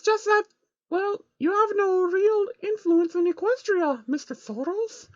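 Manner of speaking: sheepish high voice on the edge of crying. A narrator's reading. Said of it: "Trixie responded sheepishly".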